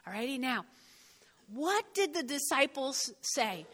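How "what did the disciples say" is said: usual speaking voice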